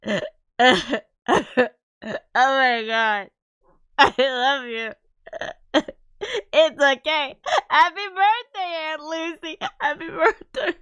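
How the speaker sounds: crying through the words, real emotion. Real, not acted. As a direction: voice breaking with tears, high and loud. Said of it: "I can feel, like, tears just coming right now".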